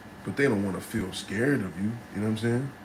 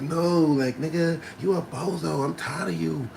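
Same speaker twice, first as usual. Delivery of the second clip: put-on high, fed-up girlfriend; mock fed-up girlfriend; 5 semitones higher than usual. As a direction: scold him as his fed-up girl. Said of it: "she's like".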